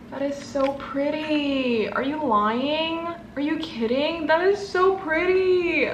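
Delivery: slow, high, drawn-out gushing; awed gushing. Real, not acted. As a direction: swoon over it, stretching the words. Gushing over a gorgeous notepad.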